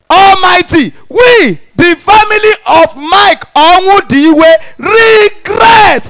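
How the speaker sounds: hammed-up solemn obituary read, high and slow; mock solemn announcement. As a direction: read the obituary like grand news. Mock reading poor obituary.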